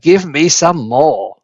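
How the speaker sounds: slow, greedy demand; greedy old woman. A narrator's reading. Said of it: "she cried".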